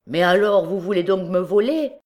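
high, loud incredulous question; comic astonishment. A narrator's reading. Victim realises the bandit means robbery, comic astonishment. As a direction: ask it aghast, almost comically amazed. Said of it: "avec un étonnement qui fût devenu comique".

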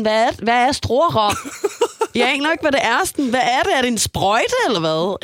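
put-on girl grilling boyfriend, high and incredulous; baffled outrage. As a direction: demand to know what it is. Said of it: "jeg er sådan".

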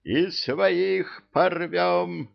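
high, slow gleeful roar; gleeful devils. A narrator's reading. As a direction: agree with a devilish glee. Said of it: "соглашались нечистые силы". (довольный рёв нечисти)

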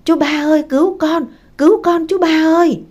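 high, loud desperate call; cry for help. A narrator's reading. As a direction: call out urgently for rescue. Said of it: "Mằn la lên".

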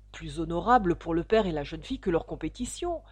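usual speaking voice

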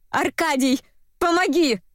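high, restrained cry for help; desperate cry. A narrator's reading. Dobronina calls Arkady to help, desperately. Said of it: "раздался истошный крик".

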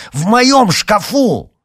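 high, loud, drawn-out howl; slow, indignant high shout; outraged yell. A narrator's reading. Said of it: "завопил Никита".

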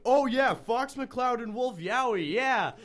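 high, loud imitation of an eager fan; imitating someone thrilled; mock enthusiasm. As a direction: tease with exaggerated excitement. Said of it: "you're like, you're like".

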